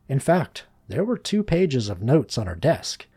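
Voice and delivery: usual speaking voice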